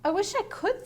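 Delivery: high, small miserable thought. A narrator's reading. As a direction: wish it in misery. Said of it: "thought Chrysanthemum miserably".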